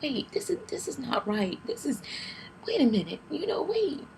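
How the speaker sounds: panicked plea. Retold or imitated. Pleading with the doctor in labour, high panicked cry. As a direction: beg them to stop, voice cracking. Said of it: "So I said".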